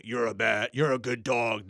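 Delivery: stern scolding bark with kind words; angry voice. Shown as a demonstration. Talking to a dog angrily while praising it. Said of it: "you know, like, angry".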